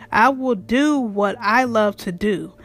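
normal talking voice

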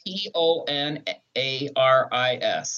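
slow letter-by-letter spelling. Shown as a demonstration. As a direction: spell it out one letter at a time. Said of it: "How do you spell that?".

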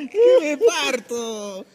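high cackling laugh, then laughing the words out slowly; mocking laughter. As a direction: burst out laughing at the lion. Cat mocks the little lion. (carcajada burlona y aguda)

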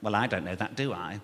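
low, flat jobsworth drone; parking officer. Retold or imitated. Comedian voicing the parking officer, low jobsworth drone.

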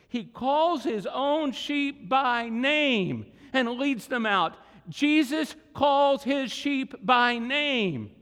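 preaching at full force. Acted. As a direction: build the congregation up word by word. Preaching climax, building.

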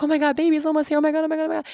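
high, eager oh my Gods; bubbling over, almost there; excited anticipation. Retold or imitated. Landing in Marseille before seeing the baby, high excited gushing. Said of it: "and I'm like".

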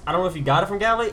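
ordinary talk, nothing marked

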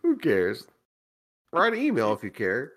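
ordinary talk, nothing marked

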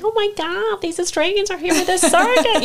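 very high, gushing excitement; mock gushing doctors. Doctors thrilled by the Australians, imitated in a very high gush. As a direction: squeal it like thrilled hospital staff.

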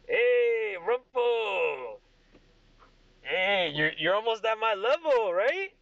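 put-on loud, high, rowdy drunk at the bar; Craig. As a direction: holler it like a tipsy show-off. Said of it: "and Craig goes".

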